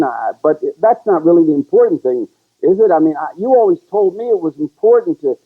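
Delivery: put-on a young son pleading with his dad. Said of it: "let's pretend you're my dad".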